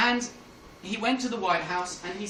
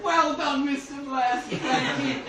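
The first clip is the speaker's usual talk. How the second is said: lightly voiced queen, higher pitch; congratulating queen; 5 semitones higher, 4 dB louder, slower than usual. The Queen thanks Mister Blair for his answer, congratulating. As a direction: praise him in a slightly raised queenly pitch.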